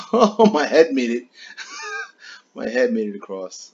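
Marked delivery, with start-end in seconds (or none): 0.0-2.5 high squeal of laughter, louder, through giggles; half-crying with laughter; laughing. Real, not acted. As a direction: crack up while you say it. Laughing at falling game character.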